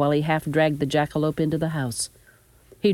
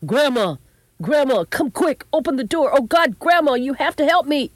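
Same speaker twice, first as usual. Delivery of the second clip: high, loud, desperate shouting through a door; urgent plea at high pitch; frantic calling; 11 semitones higher, 6 dB louder than usual. A narrator's reading. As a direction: cry out in panic for help. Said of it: "someone started hammering on the door".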